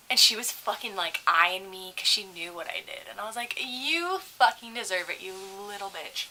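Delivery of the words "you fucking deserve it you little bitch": slow, relished put-down; spiteful retort. Retold or imitated. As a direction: draw it out with quiet spite. Acts out spite at bad tipper.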